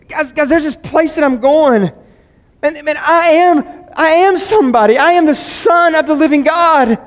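high, loud triumphant declaration, slowing on I am; proclaiming Jesus. Acted. Jesus telling his disciples who he is, loud and triumphant. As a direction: proclaim it to the disciples with full conviction. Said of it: "That's what he's proclaiming here".

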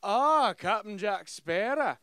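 loud, drawn-out villain greeting; mock a weak sound-alike actor; bad Davy Jones imitation. Mocking the game's Davy Jones actor, theatrical villain voice. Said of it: "This guy's sitting there, he's like".

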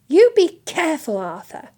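high, loud warning, dropping on the name; scolding wifely caution; sharp. A narrator's reading. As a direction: warn your husband sharply. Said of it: "said Missus Weasley sharply".

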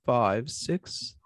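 usual speaking voice

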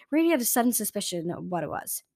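usual speaking voice

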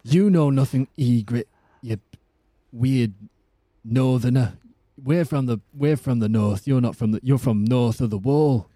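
Northern English warrior, halting phrases; Jon Snow. Acted. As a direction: put on a dramatic Northern English accent. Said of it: "Can you do Jon Snow?".

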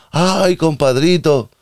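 put-on slow, high drawn-out Mexican accent. Policeman sympathising, slow high Mexican accent. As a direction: stretch it like a Mexican buddy. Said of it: "el acento mexicano".

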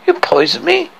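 very high, acted shocked question; high-voiced hurt disbelief, not shouted; fear mingled with anger. Girl learning the dwarfs poisoned her, fearful and angry. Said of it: "fear and anger mingling in her voice".

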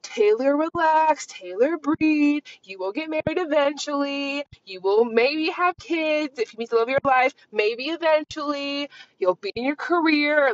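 calm yourself like a patient friend; soothing self-talk. Retold or imitated. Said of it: "coping mechanism where I'm like".

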